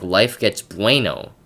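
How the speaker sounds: slow slogan, "bueno" with a long AY; bueno said as BWAY-no. Retold or imitated. Viewer mocking an ad's catchphrase, "bueno" as bway-no. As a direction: stretch "bueno" into bway-no, mimicking the ad. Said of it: "they say it like, "A, a, no".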